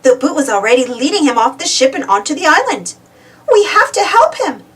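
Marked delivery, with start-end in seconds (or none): 3.5-4.6 high, urgent exclamation; urgent exclaim. A narrator's reading. Uniqua insisting they rescue the captain, urgent. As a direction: insist they must help. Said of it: "Uniqua exclaimed".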